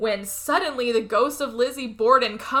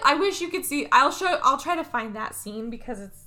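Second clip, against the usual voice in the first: usual speaking voice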